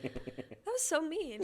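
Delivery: mock hurt whine. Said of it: "I was like".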